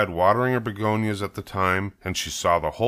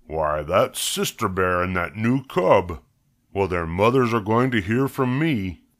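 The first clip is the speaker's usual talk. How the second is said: indignant farmer. Acted. Farmer spots cubs loading up on apples, indignant.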